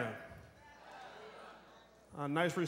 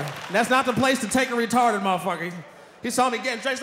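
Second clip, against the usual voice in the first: ordinary delivery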